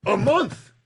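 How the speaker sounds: mounting retold pressure; escalating challenge. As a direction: press harder with each question.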